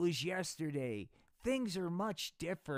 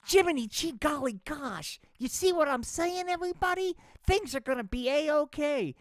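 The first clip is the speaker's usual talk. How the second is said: put-on much higher, eager gee-whiz boy; eager kid voice; 12 semitones higher, 7 dB louder than usual. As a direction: chirp it like an eager little kid. Spanky's excited kid voice.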